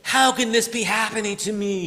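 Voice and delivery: despairing. Retold or imitated. A hurting person lamenting to heaven. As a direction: cry out at heaven in mild mock despair. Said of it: "could say".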